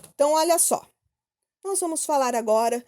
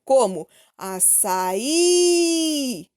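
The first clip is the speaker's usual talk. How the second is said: açaí drawn out, last syllable í stretched and stressed; slow, hit the final í in açaí; teacher stretching the final stressed syllable; much slower than usual. Shown as a demonstration. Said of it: "a última sílaba é tônica".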